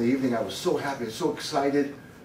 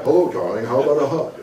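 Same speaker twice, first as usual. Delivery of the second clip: louder TV presenter greeting his wife; stuck announcer voice; 3 dB louder, faster than usual. Acted. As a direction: romance delivered like a show intro. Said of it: "you get into this voice".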